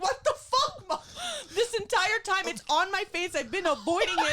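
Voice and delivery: high, laughing mock outrage at mom. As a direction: shout it through laughter. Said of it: "And I'm like".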